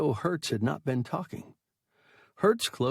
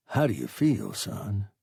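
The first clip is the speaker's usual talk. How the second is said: usual speaking voice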